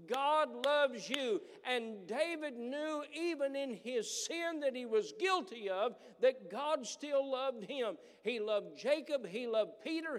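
loud, high, driving pulpit preaching; preacher pressing home that God loves you; full-force preaching. Acted. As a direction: preach it out and lift the whole room.

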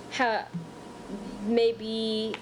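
ordinary talk

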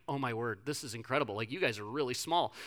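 low, quiet stunned aside; awed mutter. Retold or imitated. Counselor seeing the tiny kids, a hushed stunned mutter. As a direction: murmur it in disbelief at how small they are. Inner reaction to tiny campers.

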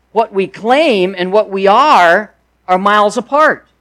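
ordinary delivery

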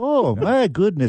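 high-pitched mock-Canadian exclamation; hammed-up Canadian; Canadian accent. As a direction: fret like a polite Canadian. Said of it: "you got a Canadian accent".

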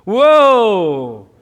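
long, loud falling 'whoa'; big drawn-out mock cry, sliding down; diver's whoop. Showing a jump from two hundred feet, loud whoa.

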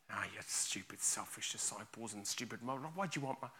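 low, muttered telling-off; mock scolding. Imagined teacher to selfish disciples, muttered scolding. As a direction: scold under your breath, low and soft. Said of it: "he doesn't scold them".

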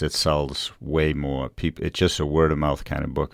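ordinary talk, nothing marked

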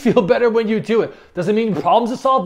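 ordinary talk, nothing marked

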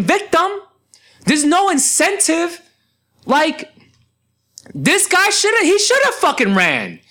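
high-pitched outraged rant at the law; exasperated rant. Real, not acted. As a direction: throw the question up at the law, indignant. Ranting at the law.

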